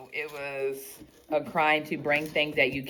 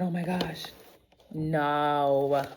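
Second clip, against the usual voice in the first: usual speaking voice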